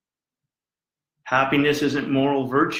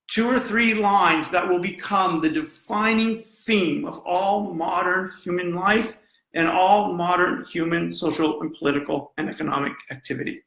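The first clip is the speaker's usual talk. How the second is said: usual speaking voice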